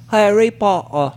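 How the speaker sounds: Harry Potter in fake English accent; playful put-on English accent; mock English accent.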